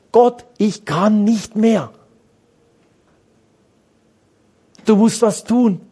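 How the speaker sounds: a desperate cry upward, drawn out slowly; crying out to God. Shown as a demonstration. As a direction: call out to God as if at the end of your strength. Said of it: "Wie viele schreien so?".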